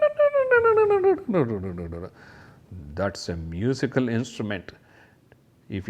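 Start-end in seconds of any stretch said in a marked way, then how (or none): 0.0-2.1 high chiming tings falling away; xylophone tinkling. Shown as a demonstration. Teacher imitating a xylophone for kids, high tings. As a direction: play the notes with your voice. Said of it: "You can create your own music".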